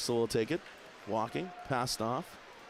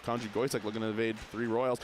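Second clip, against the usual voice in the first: usual speaking voice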